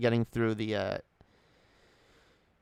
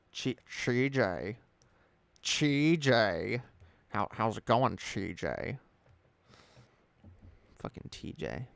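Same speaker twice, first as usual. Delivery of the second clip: put-on drunk slur; 4 dB quieter, slower than usual. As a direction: mumble it like a sloppy drunk. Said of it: "I'm drunk?".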